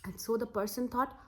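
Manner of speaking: usual speaking voice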